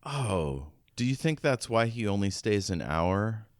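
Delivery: ordinary talk, nothing marked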